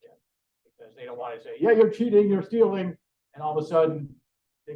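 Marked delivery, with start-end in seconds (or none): 1.6-3.0 raised, higher accusing voice; mock accusation. Detector makers accusing a student of cheating, accusatory. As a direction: call out a cheat, pitched up.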